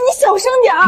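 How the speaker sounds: high, fast, urgent plea; flustered shushing. Acted. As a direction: hurriedly beg a colleague to keep it down. Embarrassed, hushes loud colleague. (慌张劝阻)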